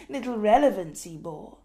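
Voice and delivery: ordinary delivery, nothing marked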